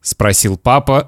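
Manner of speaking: usual speaking voice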